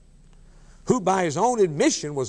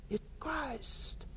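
hushed; 9 semitones higher, 15 dB quieter than usual. Acted. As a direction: drop almost to nothing, hushed awe. Preacher drops to near silence.

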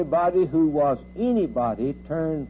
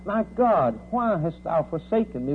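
raised, higher preacher's cry; mild scream, pitched up in anguish; retold scream; 3 semitones higher than usual. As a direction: cry it out without fully screaming. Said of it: "And he screamed out".

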